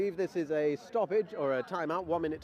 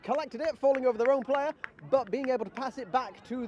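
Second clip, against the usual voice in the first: play-by-play; 8 semitones higher, 4 dB louder than usual. Acted. Live play-by-play excitement.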